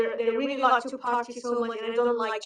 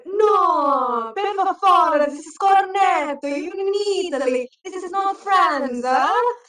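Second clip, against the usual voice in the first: much higher, louder scolding Italian voice; loud Italian barista; 6 semitones higher, 7 dB louder, slower than usual. Retold or imitated. Barista correcting her French order, loud and high. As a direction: boom it out like an exasperated barista. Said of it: "very loud Italian".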